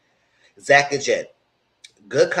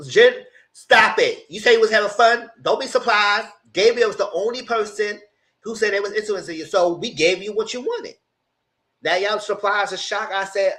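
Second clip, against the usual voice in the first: exasperated rant; 7 semitones higher than usual. Real, not acted. Ranting at the contestants.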